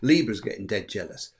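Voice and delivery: ordinary talk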